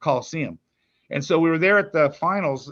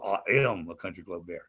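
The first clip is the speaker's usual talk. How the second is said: Bear's voice; 8 semitones lower, 5 dB quieter than usual. Shown as a demonstration. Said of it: "you can hear that little".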